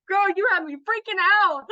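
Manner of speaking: high, loud excited retelling; excited squeal. Retold or imitated. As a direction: squeal it like you can't believe it. Retelling her own excited reply.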